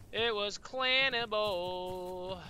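very slow, stretched mock-solemn restatement; slow, mock-official repeat; drawn out. Shown as a demonstration. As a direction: stretch every word like a grand verdict.

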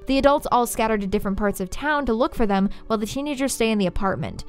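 plain reading voice

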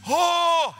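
high, loud "oh" wail; overwhelmed wail. Retold or imitated. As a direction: wail it out in amazement. Healed man wails, leaving.

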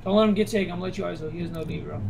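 ordinary delivery

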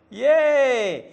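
loud, high, drawn-out cheer; long encouraging hooray; teacher's cheer. Real, not acted. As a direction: celebrate with a big high whoop. Teacher cheers the finished example.

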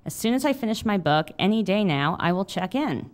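steady memoir reading voice; reading aloud. A narrator's reading. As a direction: read it like an author at a reading. Memoir read aloud to audience.

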